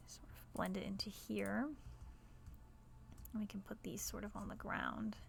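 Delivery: soft whispered aside while placing rocks. Real, not acted. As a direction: drop to a whisper and murmur to yourself. Quiet commentary while building.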